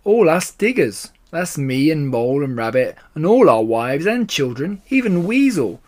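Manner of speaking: storybook badger, sinking to despair at the end; agitated Badger. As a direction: plead the diggers' case, worked up. Narrator voices Badger.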